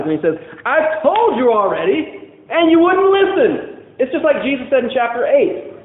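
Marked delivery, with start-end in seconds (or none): 0.7-3.8 exasperated retort. Acted. Blind man retorting to Pharisees.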